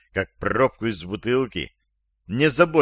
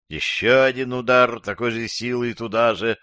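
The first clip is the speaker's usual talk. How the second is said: ordinary delivery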